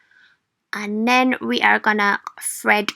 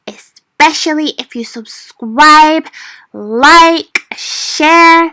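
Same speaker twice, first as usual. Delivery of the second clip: loud, upbeat call to subscribe; excited outro; 4 semitones higher, 6 dB louder, slower than usual. Real, not acted. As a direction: pump it up for the sign-off.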